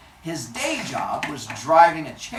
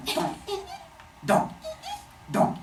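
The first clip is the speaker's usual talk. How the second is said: short, separate dunk noises; spaced vocal thunks; jabbing sound effect; 3 dB quieter than usual. Acted. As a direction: imitate a knife punching holes, one jab at a time. Imitates jabbing holes in the shell.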